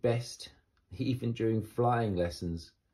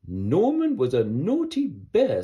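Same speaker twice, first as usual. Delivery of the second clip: louder, higher, grand royal scolding; stern Queen; 7 semitones higher, 8 dB louder than usual. Acted. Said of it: "said the Queen".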